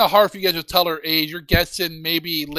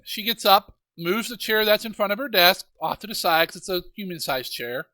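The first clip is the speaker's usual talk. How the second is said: ordinary talk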